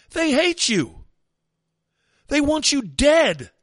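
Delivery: shouting. Retold or imitated. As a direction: shake them with the words.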